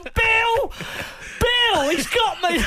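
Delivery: high, loud yell for help; panicked shout. Retold or imitated. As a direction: cry out to a friend while laughing. Calling for help, pinned.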